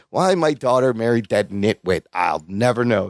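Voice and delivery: low, resigned character voice; low mock grumble; imitating a grumbling boss. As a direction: drop the pitch and grumble wearily. Acting Castleman grumbling about son-in-law.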